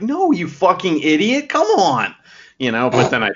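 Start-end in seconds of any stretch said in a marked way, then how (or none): 0.0-2.1 higher, drawn-out mock outrage; hammed-up indignant retort; mock exasperation. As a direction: scold in playful exasperation. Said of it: "I'm like".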